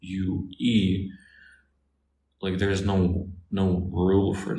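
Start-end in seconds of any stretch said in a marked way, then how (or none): 0.0-1.1 spelling out each letter very slowly; letter by letter, slow and deliberate; spelled out. Shown as a demonstration. Spelling queue letter by letter for viewers, very slowly. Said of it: "like, this word Q".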